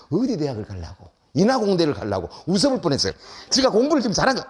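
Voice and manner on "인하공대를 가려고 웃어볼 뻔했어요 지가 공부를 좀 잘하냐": ordinary talk, nothing marked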